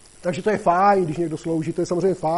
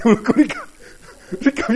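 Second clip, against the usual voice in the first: laughing; 7 semitones higher, 6 dB louder than usual. Real, not acted. Laughs telling breastfeeding anecdote.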